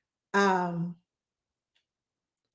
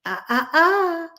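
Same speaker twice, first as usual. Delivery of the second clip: put-on baby's warning sing-song; 10 semitones higher, 4 dB louder, slower than usual. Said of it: "she goes".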